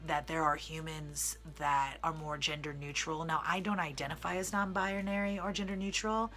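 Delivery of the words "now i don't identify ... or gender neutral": ordinary talk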